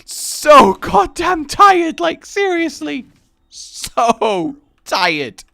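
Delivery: high, loud, laughing through the words; real laughter squeezing the voice up high; helpless laughter. Real, not acted. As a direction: struggle to get it out while cracking up. Cracking up at own sleepy logic.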